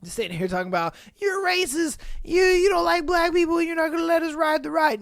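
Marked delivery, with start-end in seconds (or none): 1.2-5.0 high-pitched mock accusation; complaining parent. Guest accusing the ride operator, mock indignant high voice.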